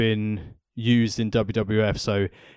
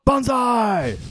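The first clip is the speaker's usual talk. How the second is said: high, loud mock battle cry; hammed-up fake Japanese shout; 12 semitones higher, 3 dB louder than usual. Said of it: "Fake Japanese talking".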